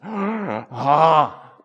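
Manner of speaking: an overwhelmed sigh of wonder; delighted gasp. Retold or imitated. Pastor finally reads the book, delighted ah.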